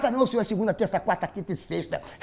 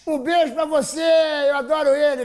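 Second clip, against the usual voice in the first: shout-out; 7 semitones higher than usual. Real, not acted. Shout-out to sponsor. (grito animado)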